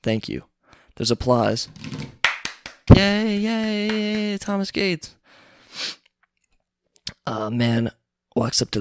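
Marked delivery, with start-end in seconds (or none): 2.9-5.1 high, thin mock cheering. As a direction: cheer like a polite crowd, in jest. Crowd cheers the honoree.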